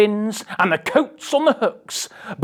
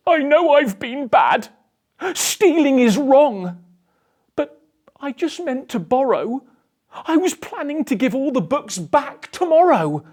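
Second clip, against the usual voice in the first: higher, lightly voiced remorseful thief; sorry book thief; 8 semitones higher, 3 dB louder than usual. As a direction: plead mildly, guilty but hopeful. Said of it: "he sniffled".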